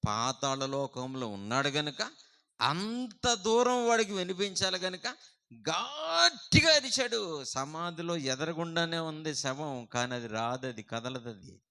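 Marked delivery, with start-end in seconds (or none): 2.6-7.4 emphatic preaching. Acted. Preaching climax.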